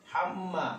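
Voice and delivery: হাম মা with the m held across both syllables; doubled m demo. Shown as a demonstration. Teacher shows mim coming twice, doubled-m demo. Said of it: "মিম কিন্তু দুবার আসছে".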